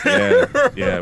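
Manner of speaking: high squeaky laugh through yeah; cracking up mid-word. Real, not acted. Laughing at uncle joke.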